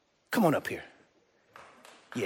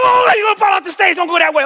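mock panic warning; 9 semitones higher, 4 dB louder, faster than usual. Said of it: "And I'm".

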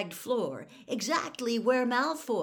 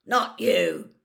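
loud, impatient growl; Moody; 8 dB louder, much slower than usual. A narrator's reading. Moody points Harry to Crabbe behind him, growled. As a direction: growl it as a curt correction. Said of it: "Moody growled".

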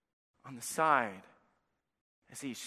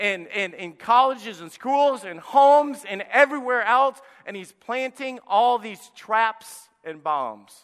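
full-force preaching; 7 semitones higher, 9 dB louder than usual. Acted. Preacher to his congregation, loud full-force preaching.

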